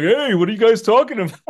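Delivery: high, loud, fast oblivious interruption; Ox King. Retold or imitated. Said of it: "Ox King's humongo face comes in frame".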